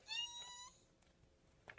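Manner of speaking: very high, drawn-out ee; brake squeal. Shown as a demonstration. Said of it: "the brakes go".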